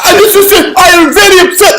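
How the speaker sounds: very high, loud mock outrage; hammed-up upset scam caller. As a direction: imitate an agitated caller losing it. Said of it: "he raised his voice".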